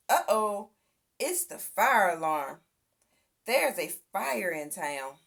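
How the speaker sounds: ordinary delivery, nothing marked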